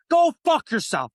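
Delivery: high, loud, slow cursing; angry curse. Real, not acted. Streamer cursing out TikTok, high and loud. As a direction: spit it at TikTok.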